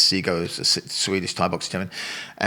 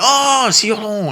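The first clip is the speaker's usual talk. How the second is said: high, loud, drawn-out cry of recognition; big imitated "aha" moment, pitched right up; mock sudden recognition; 13 semitones higher, 7 dB louder, slower than usual. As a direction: stretch it out as if the penny has finally dropped. Drivers suddenly recognise the name.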